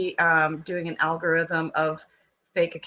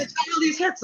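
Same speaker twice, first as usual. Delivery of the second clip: high, mocking sing-song; mocking hype voice; 10 semitones higher than usual. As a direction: parrot the hype in a high whine. Mocking the hype, much higher.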